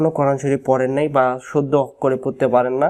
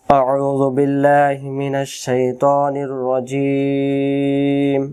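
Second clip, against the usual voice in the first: slow, measured recitation tone; slow Arabic Quran recitation; Quranic recitation; much slower than usual. Acted. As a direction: recite it slowly and reverently.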